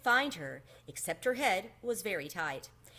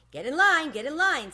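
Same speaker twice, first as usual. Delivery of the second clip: raised, brisk teacher call; 7 semitones higher, 7 dB louder than usual. A narrator's reading. Teacher calls her class into line, firm and raised. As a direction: round them up like a teacher.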